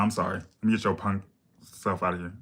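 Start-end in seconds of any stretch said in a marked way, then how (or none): none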